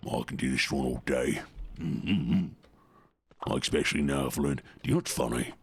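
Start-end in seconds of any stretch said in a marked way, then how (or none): 0.1-2.5 a lightly voiced orc; low growling orc, a hint of it. Shown as a demonstration. Streamer showing chat the orc voice he can do, lightly growled. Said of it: "if you want me to do the orc".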